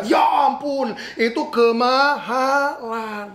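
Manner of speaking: wife gasping at the price, drawn out slowly; shocked wife. Acted. Wife hears the pencil cost a million, shocked. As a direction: stretch out kemahalan in disbelief.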